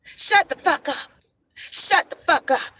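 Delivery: high, loud, slow mocking snap; mocking a hostile commenter, high and loud; mock angry man. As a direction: imitate his rude outburst, high and loud. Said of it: "And here he goes".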